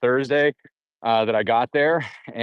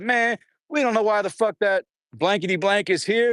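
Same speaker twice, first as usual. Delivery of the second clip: higher, dismissive jailhouse voice; scornful inmate; 8 semitones higher than usual. Retold or imitated. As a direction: brush him off with contempt. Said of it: "They said".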